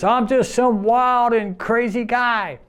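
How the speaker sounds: high, slow goofy showman voice; Steve Martin impression. Coworker [name] doing a Steve Martin bit at work, high and slow goofy. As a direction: ham it up like a TV comic. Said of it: "That's Steve Martin".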